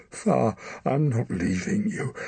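high, slow grieving plea; anguished plea. Acted. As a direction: refuse to let go. Son clings to dying father.

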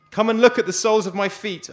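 a prisoner screaming to be seen. Acted. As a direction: scream it for your life. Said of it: "I scream".

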